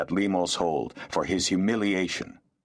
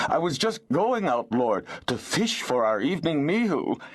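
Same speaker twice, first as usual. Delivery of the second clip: higher, nervous excuse-making peasant; pleading peasant; 9 semitones higher than usual. Acted. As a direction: wheedle your way out of trouble. Peasant pleading with angry lord.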